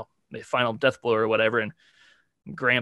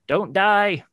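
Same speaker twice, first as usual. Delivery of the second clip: imitating a pleading child, pitched higher and louder; mock tearful plea; 9 semitones higher, 4 dB louder, slower than usual. Retelling the boy begging his dying grandpa, mock tearful plea.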